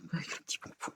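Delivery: hushed whisper. Real, not acted. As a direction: whisper it softly. Unvoiced line.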